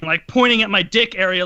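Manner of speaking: ordinary talk, nothing marked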